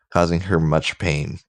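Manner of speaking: ordinary delivery, nothing marked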